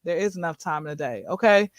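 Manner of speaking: low, quick, quiet correction; matter-of-fact rebuttal. Acted. Speaker answers the too-busy excuse, low matter-of-fact rebuttal. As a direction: drop low and soft to counter the excuse.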